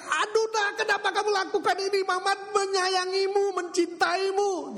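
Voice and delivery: high, pained mock wail; mother crying out. Mother cries out as her son bites her ear, high wail. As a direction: cry out in hurt disbelief. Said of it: "Mamanya teriak-teriak".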